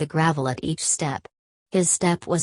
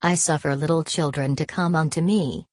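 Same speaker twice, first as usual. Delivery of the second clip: usual speaking voice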